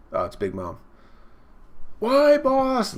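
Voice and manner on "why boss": high, loud cartoon squeal; piercing mock-pleading squeak; little pastry creatures. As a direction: cry out like a tiny minion. Little pastries question boss.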